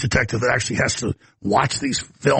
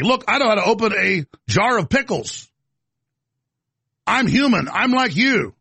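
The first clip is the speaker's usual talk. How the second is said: higher, eager fake-folksy voice; mock Hillary; 5 semitones higher than usual. Mocking the candidate with the pickle jar, higher fake-folksy. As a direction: play it as a candidate insisting she's human.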